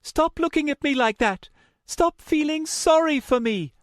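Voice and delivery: high, loud angry outburst; furious high-pitched yell; snapping. A narrator's reading. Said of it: "she finally snapped".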